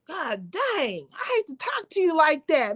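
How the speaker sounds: ordinary talk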